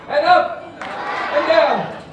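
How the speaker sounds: slow, drawn-out and loud; slow exaggerated chant; slow demo. Shown as a demonstration. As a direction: stretch each word for the kids. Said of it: "Give it a try".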